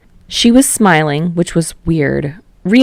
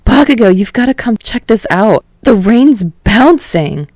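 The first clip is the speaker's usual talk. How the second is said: excited call; 5 semitones higher, 4 dB louder than usual. Acted. Calling friend to the window.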